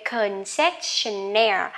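Concessionaire said slowly, stress on -naire; slow model word. Shown as a demonstration. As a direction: stretch Concessionaire out. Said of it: "concessionaire có nghĩa là". (đọc chậm Concessionaire)

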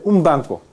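"un banco" with the n of un said as m; assimilated nasal in "un banco". Shown as a demonstration. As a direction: say "un banco" run together as "um banco". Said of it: "Así ocurre la asimilación regresiva". ("un banco" con la n pronunciada como m)

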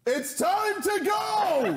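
high, slow shouted exit line; mock shout. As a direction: bellow it out to end the scene. Abruptly ending the interview.

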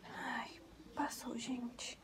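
slow, breathy whisper of relief; relieved whisper. Real, not acted. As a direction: exhale as the pain fades. Contraction just ended, relief.

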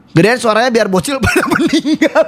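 real laugh taking over the words; breaking into laughter. Real, not acted. Streamer joking about turning the volume up for kids, cracking up. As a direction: start talking, then crack up mid-sentence.

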